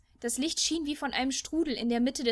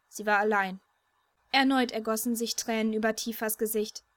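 ordinary delivery

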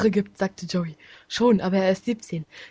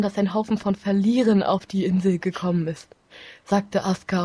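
ordinary delivery

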